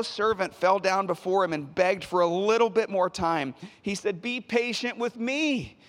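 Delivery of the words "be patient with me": higher-pitched plea, louder as it goes on; pleading. A narrator's reading. Servant begs his creditor for more time, pleading. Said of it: "he pleaded".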